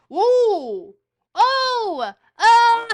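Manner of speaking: high, very loud rising excitement in whoops; triumphant whoops. Real, not acted. Streamer sees the guess land, triumphant screamed whoops.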